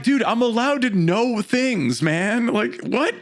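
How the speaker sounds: high, drawn-out indignant retort; exasperated protest. Acted. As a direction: plead your case in disbelief. Acted retort defending knowing things.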